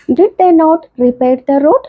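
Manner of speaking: very slow, word-by-word example read; slow classroom reading of an English example; slow dictation. Shown as a demonstration. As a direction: spell the sentence out slowly for students. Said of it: "teacher dictating example sentence".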